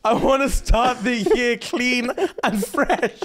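put-on slow, high Nigerian mum accent. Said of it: "I couldn't hear that in a Nigerian accent".

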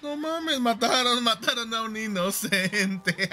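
laughing through the words; giggling while talking. Real, not acted. Laughing at teammate's death.

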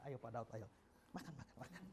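softly insistent host; hushed urging. Retold or imitated. Host urging guest while hitting child.

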